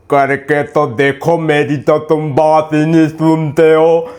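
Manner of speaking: low, slow mimicry of her; exaggerated low nagging impression; mocking imitation. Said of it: "ऐसे नहीं बोलते, बहुत प्यार से बोला".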